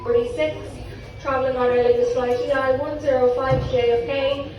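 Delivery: airport announcement. Real, not acted. Second agent's boarding call.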